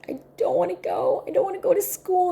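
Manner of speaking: put-on high, mildly wailed child's protest; imitated crying child. Niece refusing school, imitated tearful protest. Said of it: "She burst out crying, and she's like".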